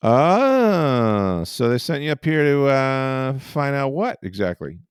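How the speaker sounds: mild imitation of a curious old writer; nosy baseball writer. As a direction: quiz the stranger, a bit suspicious. Curious baseball writer questions newcomer.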